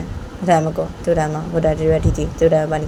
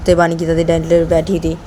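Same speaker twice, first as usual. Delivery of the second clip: plain reading voice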